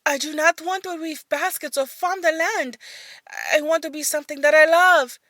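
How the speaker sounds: high, loud outburst; blurted out. Acted. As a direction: blurt it out at her mother. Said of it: "blurted out".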